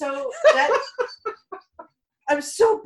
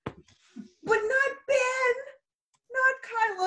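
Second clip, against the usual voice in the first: anguished wail; 11 semitones higher, much slower than usual. Real, not acted. Grieving fan lament.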